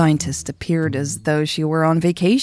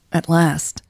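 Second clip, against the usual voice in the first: ordinary delivery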